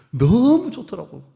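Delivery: 높고 크게, 느리게 감탄; 너무 좋았다는 들뜬 감탄. Retold or imitated. 목장 모임을 즐겁게 회상. (목장 모임이 즐거웠다고 들떠 높게 감탄)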